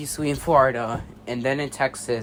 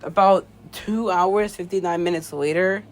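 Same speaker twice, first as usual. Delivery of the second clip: shaken and scared; 11 semitones higher, 12 dB louder than usual. Acted. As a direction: speak like someone still in shock. Shaken announcer after the villain.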